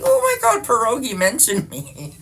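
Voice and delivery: high, loud excited squeal; fangirling. Retold or imitated. Telling viewers how she reacted to a mention, fangirling. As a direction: gush like a starstruck fangirl, pitch shooting up. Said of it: "I was, like, fangirling out".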